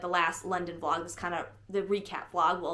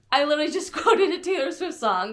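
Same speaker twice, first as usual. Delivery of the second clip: real laughter breaking into the words, higher pitched; laughing; 8 semitones higher, 4 dB louder than usual. Real, not acted. Vlogger caught quoting a song by accident, laughing. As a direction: crack up while talking. Cracks up at accidental lyric quote.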